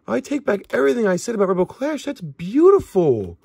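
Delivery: high, gushing amazement; gush, then stretch out the praise; real delight. Real, not acted. Card opener taking back doubts about Rebel Clash, thrilled. Delighted after the rare pull.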